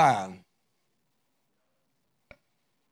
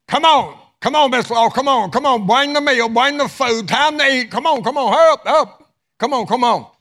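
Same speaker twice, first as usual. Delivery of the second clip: very high, loud frantic calling; hollering; 10 semitones higher, 7 dB louder than usual. Acted. Husband hollers at his wife to bring the meal, frantic and loud. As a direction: yell for her to hurry up. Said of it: "he starts hollering to his wife".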